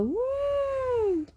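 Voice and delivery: high, held elephant call; elephant trumpet. Shown as a demonstration. Parent showing a toddler what the elephant says, high trumpeting call. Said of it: "You go".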